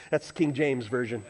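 normal talking voice

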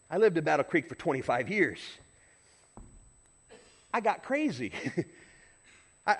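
normal talking voice

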